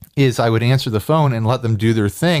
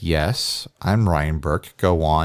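lower, flat deadpan; 8 semitones lower, 3 dB quieter, slower than usual. Retold or imitated. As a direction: answer dryly. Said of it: "I'm like".

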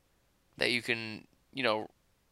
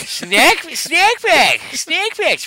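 goofy voice; 14 semitones higher, 10 dB louder, much slower than usual. Retold or imitated. Joins the Sandler bit.

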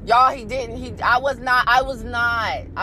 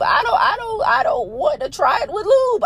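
high, raised protest; exasperated refusal; 11 semitones higher, 3 dB louder than usual. Retold or imitated. Refusing her partner's lube idea, a high raised protest. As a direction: shut the idea down.

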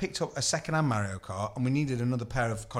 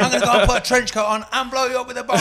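hammed-up mock threat; 10 semitones higher, 7 dB louder, faster than usual. Joking mock threat quote.

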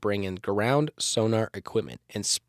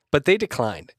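ordinary talk

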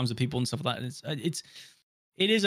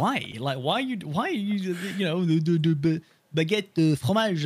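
mock French accent; 4 semitones higher than usual. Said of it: "English people trying to put on, like, a French accent".

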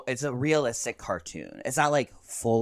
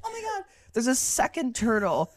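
pitched up and getting louder, mock amazed; imitating excited mom; 13 semitones higher, 6 dB louder than usual. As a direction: squeal it like a mom spotting baby turtles. Mom retelling finding more turtles.

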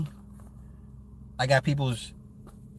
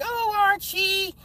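much higher, slow sing-song wife voice; Edith impression; much slower than usual. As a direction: whine it sweetly like Edith. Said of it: "Edith would be like".

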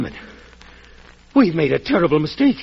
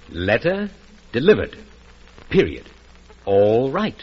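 letter, delivered, period, all right, each word set apart with pauses; read with full stops; 6 semitones lower, slower than usual. Shown as a demonstration. Partner shows the telegram's true reading, pausing between each word. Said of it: "no, you've read it wrong".

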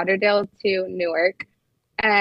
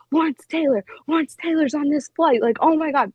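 higher, mock starstruck gasp; imitating freaking out; 6 semitones higher than usual. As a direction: play an older fan losing it over a celebrity. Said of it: "he's freaking out".

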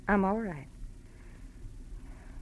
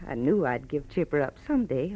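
ordinary talk

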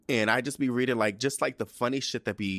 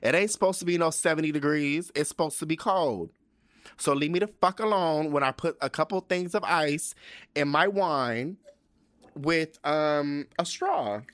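higher pitched, heated comeback; higher fired-up rant; 7 semitones higher than usual. Real, not acted. Host ranting at people who say wine should be warm, higher pitched. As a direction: push the pitch up and argue back.

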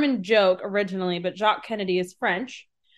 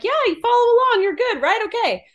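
high, quick mock instructor voice; high-pitched, hurried fake reassurance; breezy instructor; 13 semitones higher, 5 dB louder than usual. Mimics a breezy radio instructor.

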